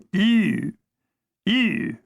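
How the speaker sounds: Ew said tight and metallic with chin pressed down; Cockney posh Ew. Shown as a demonstration. Showing the Cockney-posh sound, a pinched metallic Ew. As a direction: squeeze Ew through a pressed throat, lips pursed. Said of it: "lay it flat ... and say".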